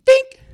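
sudden high, loud "dink"; abrupt high-pitched impact sound; imitated clink. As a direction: make a sharp rock-strike noise. Imitates digger striking a rock.

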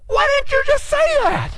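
very high-pitched whining protest; exasperated complaint. Retold or imitated. Exasperated question to instructor.

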